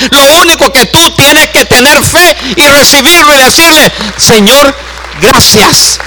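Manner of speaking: high, loud pulpit preaching; preaching at full force. Acted. Preacher urging the church to have faith, full force. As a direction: drive the message home to the congregation.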